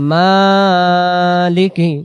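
মালিকি drawn out very slowly, each syllable held; slow drawn-out recitation. Shown as a demonstration. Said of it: "আবার". (ধীরে টেনে টেনে তিলাওয়াত)

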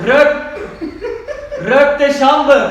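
yelling over music in headphones; shouting, can't hear yourself; too loud, headphones on. Real, not acted.

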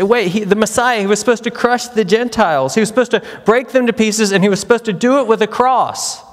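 playing the doubter who expected a conquering Messiah; indignant objector. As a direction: voice the listener's objection, lightly incredulous. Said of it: "But you say".

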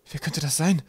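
high, urgent question; alarmed. A narrator's reading. Kaukab hears someone set the trap, alarmed. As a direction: ask with rising alarm. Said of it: "fragte Kaukab alarmiert".

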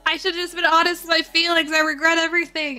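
loud, high-pitched wail of regret; mock regret. Streamer regretting honesty amid game drama, hammed-up regret.

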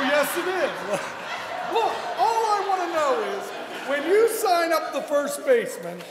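normal talking voice

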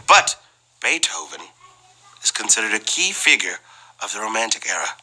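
normal talking voice

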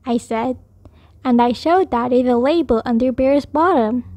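ordinary delivery

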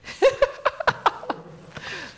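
high, voiceless wheezing laugh; breathless high laughter. Real, not acted. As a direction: let out a long high giggle. Laughing after her own story.

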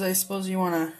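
usual speaking voice

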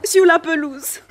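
ordinary talk

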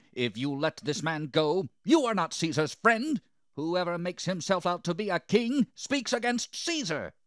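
high, loud crowd accusation; crying out. A narrator's reading. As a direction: shout it as a threatening mob.

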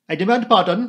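loud polite interruption; cried out. A narrator's reading. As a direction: announce yourself with a flourish. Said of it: "he cried".

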